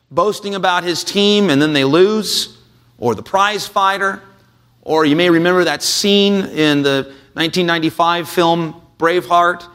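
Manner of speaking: ordinary talk, nothing marked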